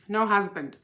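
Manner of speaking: ordinary talk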